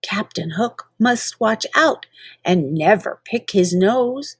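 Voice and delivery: plain reading voice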